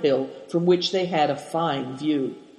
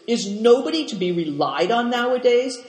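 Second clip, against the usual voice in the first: understated angry cry, pitched up; mild furious outburst; 6 semitones higher than usual. A narrator's reading. Moonlight annoyed at the late Tungat, mildly furious. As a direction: grumble in raised indignation.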